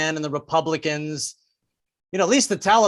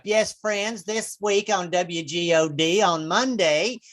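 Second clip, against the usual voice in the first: radio preacher announcing lineup. Acted. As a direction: imitate a folksy evangelist radio host. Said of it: "they give you the weekly lineup".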